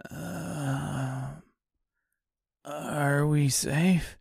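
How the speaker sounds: slow, drowsy half-awake voice; just waking up, tired and groggy. Acted. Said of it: "in a tired, groggy voice".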